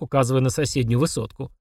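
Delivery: ordinary delivery, nothing marked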